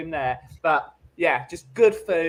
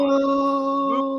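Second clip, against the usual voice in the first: long, high, hammed-up "no"; drawn-out mock disbelief; 10 semitones higher, 3 dB louder than usual. Reacting to the waitress insulting Granny, mock horror. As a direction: stretch out a high wail of disbelief.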